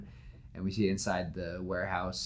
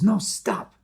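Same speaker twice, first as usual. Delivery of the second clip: much higher, louder cry to stop him; urgent shout; 11 semitones higher, 8 dB louder than usual. Retold or imitated. Villain yells at Electro to stop, urgent shout. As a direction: stop him before he touches it.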